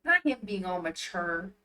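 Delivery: ordinary delivery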